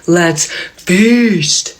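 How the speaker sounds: half-restrained battle cry, words stretched out; mock scream. Date at the taco-covered table, mock screaming for dinner to begin. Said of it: "screams at the top of his lungs".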